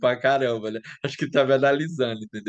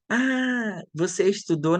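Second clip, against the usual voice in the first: mock surprise; 7 semitones higher, slower than usual. Mocking surprised host quote.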